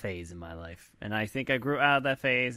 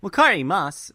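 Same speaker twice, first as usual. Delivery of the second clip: peppy anime voice; 8 semitones higher, 8 dB louder than usual. Acted. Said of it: "definitely not my regular voice".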